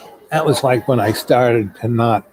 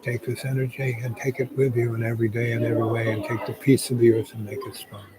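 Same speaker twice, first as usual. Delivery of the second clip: hushed, unhurried blessing and prayer; a softly murmured closing prayer; soft meditative prayer; 2 semitones lower, 6 dB quieter than usual. Acted. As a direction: pray quietly over the listeners. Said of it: "I'm going to meditate".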